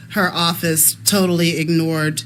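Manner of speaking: ordinary delivery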